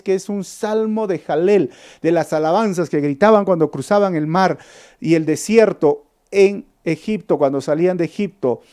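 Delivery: normal talking voice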